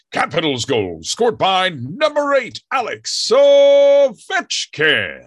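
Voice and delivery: arena announcer. Shown as a demonstration. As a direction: stretch it out like a hockey goal call. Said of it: "you sometimes hear me say things like".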